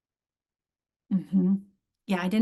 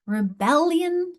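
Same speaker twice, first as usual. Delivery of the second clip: "Rebellion" slowly, high and loud on BEL; slow high punch on BEL in "Rebellion"; stressed BEL; 7 semitones higher, 5 dB louder, slower than usual. Shown as a demonstration. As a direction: stretch re-BEL-lion in "Rebellion". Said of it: "final syllable is stressed".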